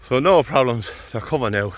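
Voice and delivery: out of breath after running uphill; breathless, puffing between words; gasping between phrases. Real, not acted. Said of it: "I'm out of breath".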